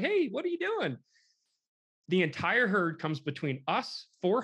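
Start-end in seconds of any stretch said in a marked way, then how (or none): 0.0-1.0 very high, put-on squeal; comic elk voice. Herd coming back to the downed elk, comic high voice. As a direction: talk as a curious elk in mock falsetto.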